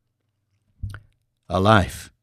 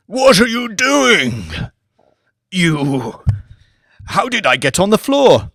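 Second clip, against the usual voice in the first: startled lord; 8 semitones higher, 6 dB louder than usual. Acted. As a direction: bark out bewildered questions. Lord revives confused and alarmed.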